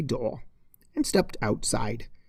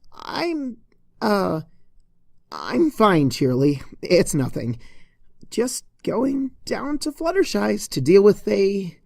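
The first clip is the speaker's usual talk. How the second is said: stammering, higher start settling down; flustered excuse; 6 semitones higher than usual. Acted. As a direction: cover up awkwardly.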